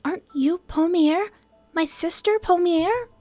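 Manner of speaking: high-pitched, bewildered demands; upset Fia character. Acted. Fia questions Quill about her sister, high and upset. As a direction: press for answers in a high voice.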